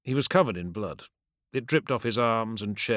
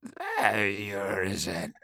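slow, weak croak; much slower than usual. Acted. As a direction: croak it out slowly, barely alive. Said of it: "Saw Talgron croaked".

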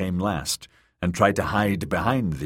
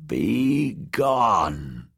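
croaking voice; 2 semitones higher than usual. Acted. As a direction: croak it slowly like an old witch. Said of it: "croaked Maggie a third time".

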